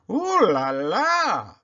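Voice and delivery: slow, high, delighted French barber. A narrator's reading. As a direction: savor it like a fussy Parisian stylist. French barber Pierre exclaims.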